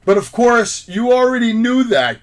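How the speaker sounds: loud, high ring announcer voice; Ricardo Rodriguez announcer. Retold or imitated. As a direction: boom it out like a wrestling ring announcer. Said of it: "Ricardo Rodriguez".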